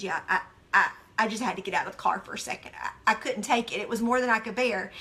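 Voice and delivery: a hint of a worn-out husband; husband quoted. Husband to his wife in the car, lightly voiced and weary. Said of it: "And he said".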